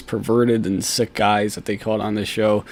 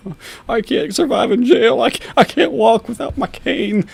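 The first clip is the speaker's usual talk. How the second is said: much higher, whining pity-me voice; squeaky mock whine; 12 semitones higher, 3 dB louder than usual. Vlogger mocking the suspect's plea in a squeaky whine. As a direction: whimper it mockingly like a helpless man.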